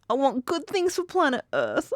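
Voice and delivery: lightly acted, high-pitched impression; Phoebe. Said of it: "she's still like".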